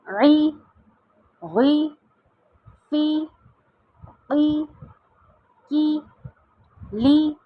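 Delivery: each syllable called out slowly with pauses; recited drill. Shown as a demonstration. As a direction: chant the alphabet with zer for pupils. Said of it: "صرف رواں کے ساتھ پڑھوں گی". (تختی کی مشق، ہر حرف رک رک کر)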